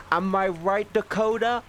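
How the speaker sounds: teasing call-out. Real, not acted. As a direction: needle a friend in chat, grinning. Teasing a chat viewer by name.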